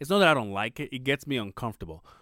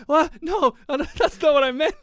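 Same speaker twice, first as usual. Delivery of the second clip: much higher, slower, laughing through it; laughing protest; 13 semitones higher, 7 dB louder, slower than usual. Retold or imitated. Retelling his panic to the host, much higher and laughing. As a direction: laugh out the denial, squeaky and high. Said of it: "I'm like".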